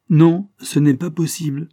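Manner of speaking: a confident voice rings out from the doorway; vibrant clear voice. Acted. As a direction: cut in boldly and clearly. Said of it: "fit une voix vibrante et claire".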